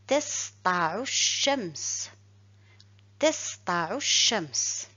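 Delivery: Tasta'u shams read slowly, L silent mid-phrase; slow Arabic reading. Shown as a demonstration. Teacher reads slowly, showing the silent L. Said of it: "Et je lis".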